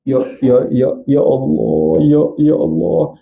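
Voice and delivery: slow, halting stutter on ya; stuttering prayer. Retold or imitated. Preacher imitates the stuttering boy praying, halting. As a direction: stumble over each ya before Allah. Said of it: "Dia gagap berdoa".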